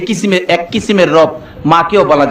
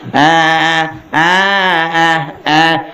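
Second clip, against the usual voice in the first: imitating a small child's whiny crying wail; mock bawling like a hungry little boy; slower than usual. Preacher imitating the crying son at night, whining wail. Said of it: "কান্দা শুরু করছে".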